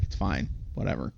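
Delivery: dismissive mumble. Retold or imitated. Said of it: "she's like".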